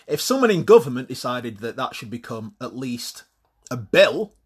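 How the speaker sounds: ordinary talk, nothing marked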